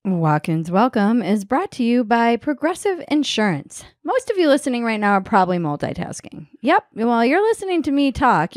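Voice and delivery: smooth, polished commercial delivery; insurance ad read. Acted. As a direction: pitch the sponsor with practised, lively phrasing. Reads insurance ad.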